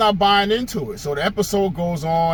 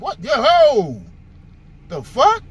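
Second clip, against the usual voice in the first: stunned; 6 semitones higher, 4 dB louder, slower than usual. Retold or imitated. Brother reacts to the woman.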